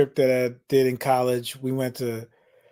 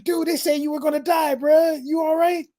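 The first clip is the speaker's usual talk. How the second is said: incredulous; 13 semitones higher than usual. Retold or imitated. Relieved friends question Mark.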